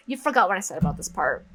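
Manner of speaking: ordinary delivery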